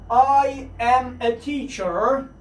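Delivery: slow, careful full form I am; drawn out full form, each word clear. Shown as a demonstration. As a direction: spell out I am slowly. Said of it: "باید شما اینها رو حتماً بدونید".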